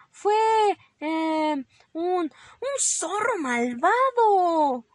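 high, slow, halting invention; hesitant fibbing. Acted. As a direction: grope for a culprit, then draw it out loudly. Inventing a new culprit, hesitating.

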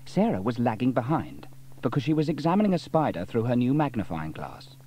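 ordinary delivery

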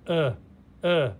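isolated "Uh" said as a short er; R-controlled vowel sound. Shown as a demonstration. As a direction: sound out "Uh" with R colouring, twice, separately. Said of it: "makes it sound like this".

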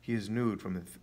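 usual speaking voice

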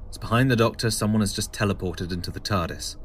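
normal talking voice

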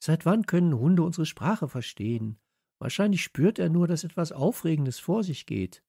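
put-on high, insistent girl's voice; high character voice, slightly scornful; girl in a story, incredulous. A narrator's reading.

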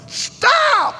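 imitated child's high-pitched holler. Young son stopping everyone at the airport gate, mock holler. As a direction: holler it way up high like a little kid. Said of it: "and John hollered".

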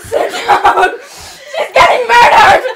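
loud shout breaking into laughter; laughing shout. Real, not acted. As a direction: yell it through real giggles. Play fight, shouting while laughing.

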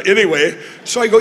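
normal talking voice

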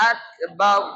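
আকবার broken by a stop after a hard k. Shown as a demonstration. Teacher leads the class to repeat, আকবার with a stop. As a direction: brake on the k, then say the rest of আকবার. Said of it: "জি, stop".

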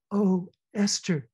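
high, slow anguished cry of a name; cried out. A narrator's reading. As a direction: cry her friend's name in grief. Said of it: "cried Ada".

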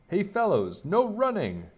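high, loud lifeguard's yell. A narrator's reading. Lifeguard to boys running at the pool, yelling. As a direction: yell a warning across the pool. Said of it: "yelled Tom the lifeguard".

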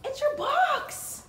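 very high, loud excited call; high loud squeal to the dog. Real, not acted.

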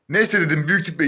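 ordinary talk, nothing marked

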